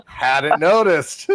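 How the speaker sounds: dry, slow sarcasm; deadpan, drawn out and mocking; sarcastic. Real, not acted. Sarcastic reply to drinking confession.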